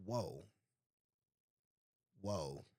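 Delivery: low, soft retold reaction; stunned, hushed. Speaker recalls hearing the young men's slang, low and stunned. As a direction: drop low and quiet, taken aback.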